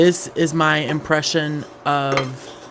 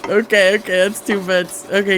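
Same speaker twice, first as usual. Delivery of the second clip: ordinary delivery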